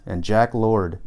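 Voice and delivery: plain reading voice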